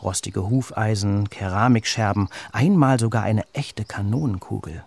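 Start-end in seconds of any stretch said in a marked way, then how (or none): none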